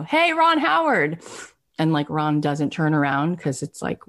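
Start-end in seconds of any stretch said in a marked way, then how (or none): 0.0-1.2 high drawn-out call out the window; mild high-pitched holler; retold yell. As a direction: hail someone across a courtyard in a mock yell. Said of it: "he screamed out the window".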